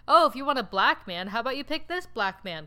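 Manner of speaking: mock suggestion. Mock quote of a tokenizing suggestion.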